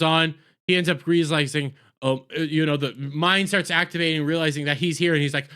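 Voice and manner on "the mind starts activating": ordinary talk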